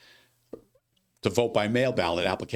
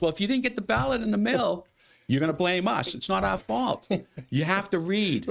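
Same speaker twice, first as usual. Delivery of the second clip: high, complaining voter blaming the office; imitating the voter; 9 semitones higher than usual. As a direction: voter complaining about a missing mail-in ballot. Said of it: "He goes".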